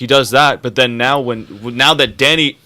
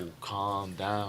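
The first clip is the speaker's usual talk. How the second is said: soft slow mock calm; 4 semitones lower, 18 dB quieter, much slower than usual. Mock advisers calming Daenerys.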